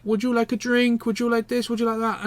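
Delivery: a hint of a pushy tout; imitated pestering tout. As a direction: run off high, repeated offers like a waiter touting. Imitating pestering restaurant staff touting.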